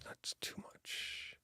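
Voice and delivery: very soft, slow whisper; hushed, drawn-out talking to oneself. Real, not acted. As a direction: mutter it under your breath, barely audible. Muttering to himself while adjusting something.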